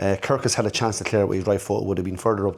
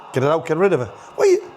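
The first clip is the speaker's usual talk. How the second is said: frantic yell; 6 semitones higher, 4 dB louder than usual. Real, not acted. Fan yelling at defenders live.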